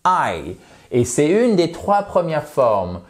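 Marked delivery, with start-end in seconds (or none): 0.1-0.6 the English letter name I, like aïe. Shown as a demonstration. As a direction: say I as in eye. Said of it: "comment on dit I en anglais".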